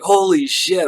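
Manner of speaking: high, loud holy shit; excited amazement. Retold or imitated. Buddies amazed at dives over the table, loud excited cry. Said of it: "They're like".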